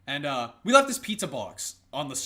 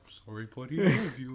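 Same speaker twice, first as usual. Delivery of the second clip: softer, lower, a mild coo; tender apology; 5 semitones lower, 3 dB quieter than usual. Retold or imitated. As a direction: quietly make up with the dog. Secretly apologizing to the dog.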